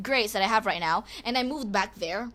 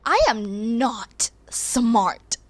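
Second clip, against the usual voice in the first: slow whisper of "I am not smart"; hushed slow confession; 4 dB louder, much slower than usual. Real, not acted.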